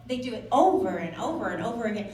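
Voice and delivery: normal talking voice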